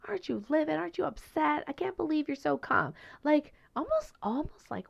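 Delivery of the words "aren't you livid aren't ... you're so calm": high, incredulous questions; incredulous commenters. Retold or imitated. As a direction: ask in disbelief that he could be so calm. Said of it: "were like".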